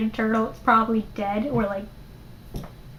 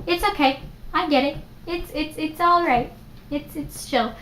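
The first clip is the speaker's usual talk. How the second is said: higher, lightly voiced friend shrugging it off; a hint of a laid-back kid saying no big deal; easygoing best friend; 5 semitones higher, 4 dB quieter than usual. Best friend telling Peter it's fine, lightly higher easygoing voice.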